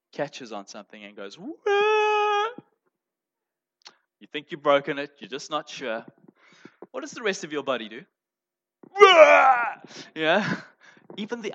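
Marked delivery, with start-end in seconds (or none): 1.4-2.5 high yelp of pain. Pretend. Stubbed pinky toe cry. 8.9-9.8 loud pained cry. Pretend. Whole body reacts to pain.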